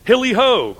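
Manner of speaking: loud, high jovial whoop; a high, loud cheer; hearty cry. A narrator's reading. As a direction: whoop it out merrily. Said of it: "cried Fezziwig".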